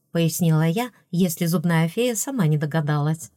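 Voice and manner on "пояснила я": ordinary delivery, nothing marked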